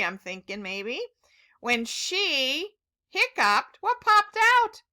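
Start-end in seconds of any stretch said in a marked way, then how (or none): 3.8-4.8 high, loud eager question; excited question. Real, not acted. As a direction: ask with big excitement. Asking child excitedly.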